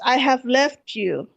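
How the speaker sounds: ordinary talk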